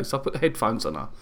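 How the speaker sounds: ordinary talk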